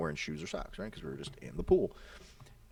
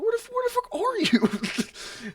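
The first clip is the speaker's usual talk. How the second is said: very high, loud, slow squeal; mock startled outcry; 5 dB louder, much slower than usual. Runner to unseen friend, hammed-up startled outcry. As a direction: draw out a high, baffled yell. Acting out own startled reply.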